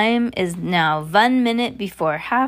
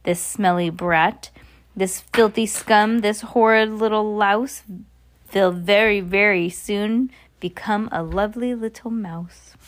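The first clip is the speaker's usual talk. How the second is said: usual speaking voice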